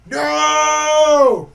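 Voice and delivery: sharp high loud shout. Real, not acted. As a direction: bark out a sudden no. Shouting at interrupting dog.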